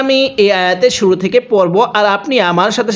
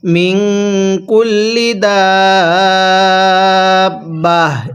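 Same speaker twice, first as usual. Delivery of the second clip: tajweed recitation; much slower than usual. Shown as a demonstration. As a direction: stretch each vowel, stop on দাব্বাহ. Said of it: "এটা তাহলে কিভাবে পড়ব?". (ওয়াকফ করে তিলাওয়াতের সুরে)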